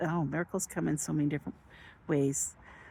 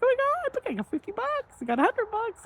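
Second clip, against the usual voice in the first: squealing with excitement. Retold or imitated. Retelling her casino win to viewers, high excited squeal. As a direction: relive the thrill of winning. Watching winnings climb.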